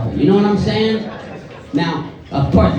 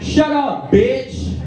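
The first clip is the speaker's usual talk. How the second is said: retold yell; 7 semitones higher, much slower than usual. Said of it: "someone yells".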